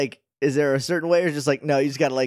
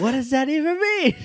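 much higher cartoon boy voice; playful high-pitched echo; mock squeaky Sora; 13 semitones higher than usual. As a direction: parrot the line in a squeaky kid voice. Echoing the squeaky Sora line.

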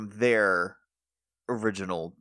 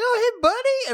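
much higher, louder, cartoonish "buddy"; mock cheery greeting; 5 dB louder than usual. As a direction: squeak it out like a goofy friend. Imitating Nick greeting Fireheart.

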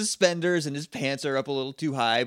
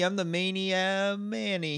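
slow, cocky self-introduction; cocky intro; 4 semitones higher, 3 dB quieter than usual. Retold or imitated. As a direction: drawl out your own name proudly. Imitating cocky character intro.

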